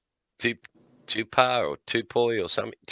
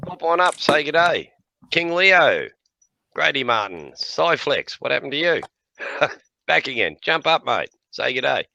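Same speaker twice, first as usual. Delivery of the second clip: higher, louder, cheery shout-outs; stream host greetings; 6 semitones higher, 4 dB louder than usual. Real, not acted. Host welcoming chat viewers to the stream, cheery call-outs. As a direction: call each viewer up to say good day.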